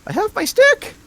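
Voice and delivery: very high, odd little creature; squeaky creature voice. Acted. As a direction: squeak every line way up high. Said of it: "Sounds like a cat".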